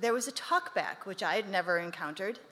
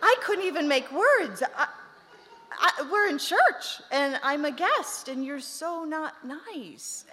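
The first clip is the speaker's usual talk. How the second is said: flustered shock; 5 semitones higher, 6 dB louder than usual. Retold or imitated. Retelling her shocked reaction.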